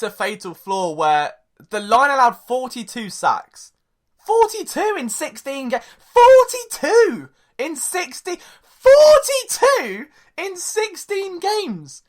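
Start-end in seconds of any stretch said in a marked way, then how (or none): 4.3-5.8 raised, incredulous pitch; high-pitched disbelief. Real, not acted. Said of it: "I can't go much higher". 6.0-8.4 loud, drawn-out, very high pitch; incredulous high wail, louder; climbing very high. Real, not acted. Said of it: "I can't go much higher". 8.6-12.0 very high, drawn-out disbelief; incredulous, stretched-out high cry; as high as possible. Real, not acted. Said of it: "I can't go much higher".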